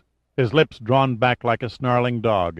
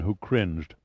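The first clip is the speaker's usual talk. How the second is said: ordinary delivery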